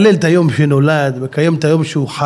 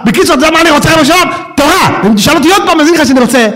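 much higher and louder, fervent; fervent plea; 11 semitones higher, 4 dB louder, much faster than usual. Acted. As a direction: beg God for Torah with rising fire. King David pleading with God.

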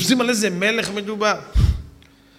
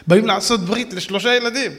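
normal talking voice

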